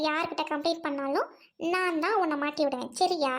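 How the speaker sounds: ordinary talk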